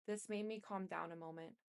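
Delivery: ordinary delivery, nothing marked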